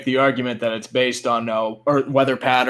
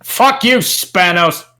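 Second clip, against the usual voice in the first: a loud, high "Fuck you, Spanos!"; shouted on request; 6 semitones higher, 5 dB louder, slower than usual. Pretend. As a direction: yell it out on demand for the fans. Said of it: "can we get a 'fuck you, Spanos'".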